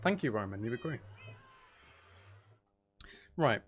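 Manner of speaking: ordinary delivery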